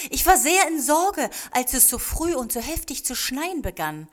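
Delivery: high, loud exclamation easing off toward the end; calling out. A narrator's reading. Old man tells the children of his worry, calling out. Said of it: "rief er".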